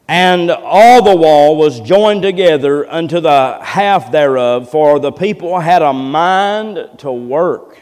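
loud, slow pulpit declamation; forceful scripture reading. Acted. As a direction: ring each phrase out to the congregation, slowly and strongly. Reads the prayer verses aloud forcefully.

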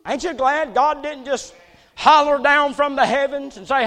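ordinary delivery

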